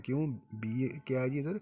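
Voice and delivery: ordinary talk, nothing marked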